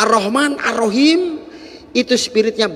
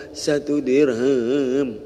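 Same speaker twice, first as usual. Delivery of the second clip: sad servant; 5 semitones lower, much slower than usual. Acted. Crying servant answers Rasulullah.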